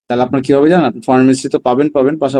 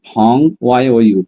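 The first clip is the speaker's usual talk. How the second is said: normal talking voice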